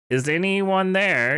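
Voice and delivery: high, slow mock yell. A narrator's reading. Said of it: "yelled Mister Norris".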